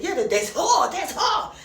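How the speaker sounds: high, loud burst of recognition; hammed-up excited identification. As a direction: blurt it out as if suddenly recognising someone. Acted recognition of the woman.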